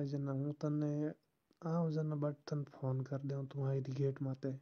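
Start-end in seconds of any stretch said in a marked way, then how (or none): none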